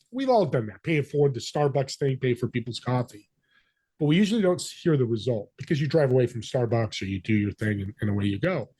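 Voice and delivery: ordinary talk, nothing marked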